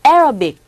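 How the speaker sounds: "Arabic" said with stress on the first syllable, "ERobik"; correct Arabic. Shown as a demonstration. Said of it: "정확한 발음은".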